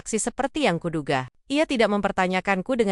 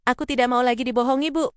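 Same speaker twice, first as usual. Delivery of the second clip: higher-pitched, mildly heated protest; raised pitch without shouting; voice starting to rise; 5 semitones higher than usual. A narrator's reading. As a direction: let the pitch climb in mild protest. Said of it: "Suaraku mulai meninggi".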